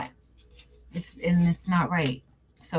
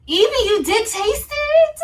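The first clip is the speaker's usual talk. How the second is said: high, loud, delighted question; thrilled a viewer tried the same treat; higher and louder, slowly savoring it; excited surprise; 14 semitones higher, 5 dB louder, slower than usual. Real, not acted. Viewer says she tried it.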